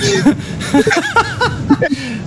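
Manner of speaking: can't hold it in, laughing high; burst of laughter. Real, not acted. Host cracks up at caller's story.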